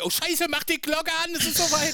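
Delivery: panic, the bell is late; panicked shout. Pretend. Panicked mock host.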